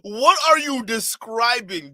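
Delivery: loud, high, drawn-out disbelief; baffled outburst. Real, not acted. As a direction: stretch the question out, loudly incredulous. Baffled reaction to the clip.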